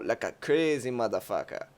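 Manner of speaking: ordinary talk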